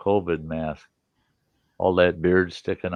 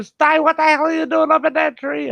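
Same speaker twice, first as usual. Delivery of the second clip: high, louder yell from below; neighbor yelling up; 5 dB louder than usual. Retold or imitated. Neighbor lady yelling up at the man in the tree, loud and high. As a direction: holler up into the tree. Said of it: "She yells up there".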